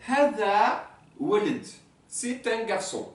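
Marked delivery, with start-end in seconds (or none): 0.1-1.7 Hada walid said slowly and clearly; slow Arabic demo. Shown as a demonstration. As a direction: model Hada walid for the class. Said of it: "c'est la première phrase".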